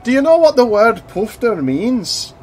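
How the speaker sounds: high, loud mock teacher telling boys off; a hammed-up headmaster lecturing the boys; stern deputy head. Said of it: "and he's like".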